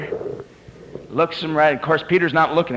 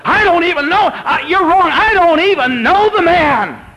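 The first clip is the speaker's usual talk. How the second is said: high, loud, desperate shout; furious swearing denial; 7 dB louder than usual. Acted. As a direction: yell it like a cornered man cursing. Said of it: "He started to curse. He started to swear".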